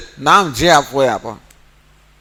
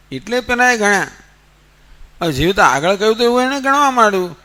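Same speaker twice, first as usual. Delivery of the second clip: ordinary delivery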